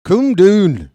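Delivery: loud, high Irish lady impression; Irish accent. Commentator showing the funny way she said it, Irish accent. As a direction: call it out in a mock Irish accent. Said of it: "the way she said it".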